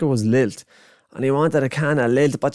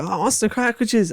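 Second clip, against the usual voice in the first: put-on kid's rant; 11 semitones higher than usual. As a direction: splutter like an outraged little kid. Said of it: "he was like".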